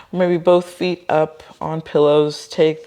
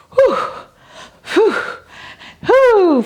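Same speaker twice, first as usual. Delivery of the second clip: sharp exhaled whews, rising; effortful breathing; 4 dB louder than usual. Real, not acted. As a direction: blow out hard through the effort. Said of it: "Feel it".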